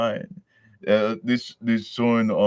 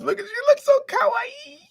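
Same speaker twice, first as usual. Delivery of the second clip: much higher, falsetto squeal; gushing fan; 4 dB louder than usual. Real, not acted. Vlogger gushing over Thirteen's face, squealing. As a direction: squeal over how cute she is.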